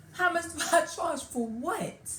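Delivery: high, loud, drawn-out disbelieving question; incredulous retort. Retold or imitated. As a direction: throw it back, stunned and indignant. Offended reply to being asked price.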